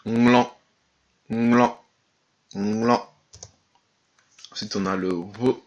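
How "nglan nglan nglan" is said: "Nglan" opening on a nasal N-G, G barely sounded; NG without hard G. Shown as a demonstration. Teacher repeats for learners, "Nglan" with swallowed G. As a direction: hum the NG into "Nglan" without releasing the G. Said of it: "on prononcerait pas vraiment le G".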